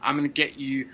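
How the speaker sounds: New York gangster voice. Retold or imitated. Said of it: "he's just like".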